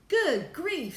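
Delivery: high, loud gasp of realisation; astonished. A narrator's reading. Astronaut realising who is speaking, astonished. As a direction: exclaim it high in shock.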